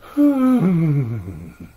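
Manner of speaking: long loud tired sigh; weary sigh. Acted. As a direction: let out a heavy weary sigh. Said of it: "Stella says with a sigh".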